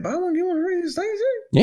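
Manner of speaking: can't believe he missed out on free shakes; amazed disbelief. Real, not acted. Hearing about the free milkshakes.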